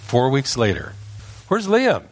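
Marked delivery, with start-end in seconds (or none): 1.5-2.0 high searching call. Retold or imitated. Family searching for the boy, calling high. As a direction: call out through the house.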